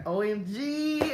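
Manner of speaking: drawn-out O M G with a long G; exclaimed as written. Shown as a demonstration. Showing viewers how the notes were written, emphatic drawl. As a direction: hold the last letter as an exclamation. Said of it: "So that's how I wrote it".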